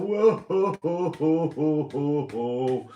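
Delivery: mimicking the clapping crowd; mock cheering. Mocking people clapping on doorsteps, hammed-up cheer.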